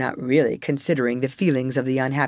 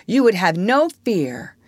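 mildly triumphant, raised pitch; 6 semitones higher, 4 dB louder than usual. Acted. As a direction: reassure them with proud certainty. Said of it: "Wendy told them triumphantly".

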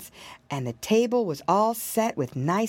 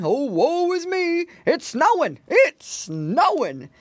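higher, theatrical wailing bear; dismayed bear crying out; 6 semitones higher, 4 dB louder than usual. A narrator's reading. Bear fooled by marshmallow snow, dismayed crying out. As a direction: wail it in hammed-up woe. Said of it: "the bear cried".

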